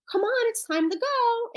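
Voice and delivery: mildly frustrated parent, pitched well up; high, lightly exasperated urging. Acted. Parent coaxing a child to leave the park, mildly frustrated. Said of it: "The parent is becoming very frustrated".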